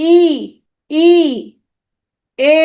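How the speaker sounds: ই and এ each held as a long pure vowel; front vowels. Shown as a demonstration. Teacher pronouncing for students to notice, ই and এ drawn out. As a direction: sustain ই, then এ, tongue forward. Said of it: "উচ্চারণ করি".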